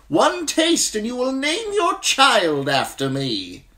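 high, loud, slow hawker's voice; eager wine merchant. A narrator's reading. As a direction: sell it to the crowd.

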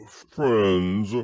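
slow grand speech opening; pompous Eeyore. A narrator's reading. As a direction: announce it like an important speaker.

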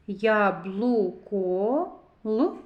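slow "Яблуко", then a lone "Л"; sounding out. Shown as a demonstration. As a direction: draw out "Яблуко" and isolate the sound "Л". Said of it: "зробимо звуковий аналіз слова яблуко".

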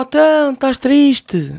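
hammed-up sympathy, drawn out slowly; mock tender concern. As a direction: imitate a gentle comforting friend. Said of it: "Então o Harry vai lá".

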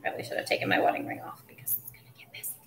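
real rushed whisper; whispered aside. Real, not acted. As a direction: mutter it quickly as if off-mic. Muttered aside while kneading dough.

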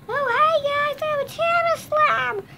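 high squeaky slime voice; Tabby Slime voice. Shown as a demonstration. Kid demoing her slime's voice, high and squeaky. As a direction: squeak it out like a tiny slime toy. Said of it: "the Tabby Slime's voice sounds like this".

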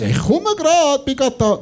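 high, slow, innocent excuse; hasty excuse. Retold or imitated. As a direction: fake being almost there.

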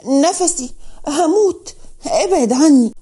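high-pitched, lightly acted choking plea; mildly strained, broken-up gasps of words; struggling to speak. A narrator's reading. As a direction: plead as if short of breath. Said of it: "اتكلمت منال بصعوبة وقالت".

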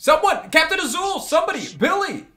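high, loud panicked calls; screaming for help. Acted. Captive calls his crew to save him, screaming. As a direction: shout desperately for anyone to come. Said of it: "he starts screaming".

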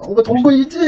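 high excited cry; excited shout. Real, not acted. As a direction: yell it out in the heat of the game. Game fight, dramatic cry.

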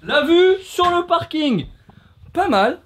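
high, drawn-out mock admiration; high-pitched showy approval; exaggerated appreciation. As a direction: stretch it out in a high voice. Hammed-up praise of parking view.

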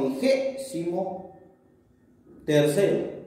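"Vigésimo" slowed with stress on GÉ, pause, "tercero"; syllable stress check. Shown as a demonstration. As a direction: split "Vigésimo ... tercero" to test each stress. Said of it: "¿se tilda o no?".

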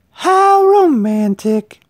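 high, very slow dreamy sigh; swooning girl. A narrator's reading. Littlest girl swooning at romance, high and slow. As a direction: stretch it out in a swoon.

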